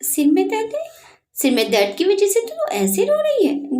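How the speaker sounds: can't believe a headache did this; surprised. A narrator's reading. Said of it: "निशा हैरानी से बोली". (हैरानी से, ऊँची आवाज़ में सवाल)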